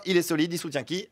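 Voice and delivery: ordinary talk, nothing marked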